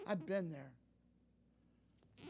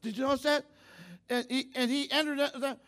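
loud, raised, exultant proclamation; full-force preaching; 6 semitones higher, 7 dB louder than usual. Real, not acted. Preacher rejoicing over the healed man praising God, full-force preaching. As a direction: belt it out to the congregation, building to a shout.

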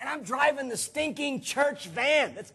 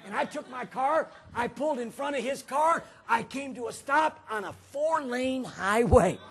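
ordinary talk, nothing marked

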